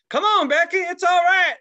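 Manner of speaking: high, loud mock holler; impatient parents calling. Parents to a scared little girl, hollered brush-off. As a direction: imitate parents hollering at their kid.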